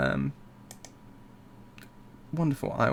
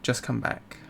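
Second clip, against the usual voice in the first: fading refrain; 10 dB quieter than usual. Acted.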